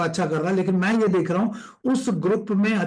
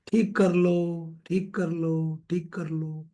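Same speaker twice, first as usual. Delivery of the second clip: slow refrain, fading softer each time; drawn-out urging; 2 semitones lower, 4 dB quieter, slower than usual. Shown as a demonstration. Coach urging students to fix the problem, slow fading refrain. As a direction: repeat it slowly, sinking away.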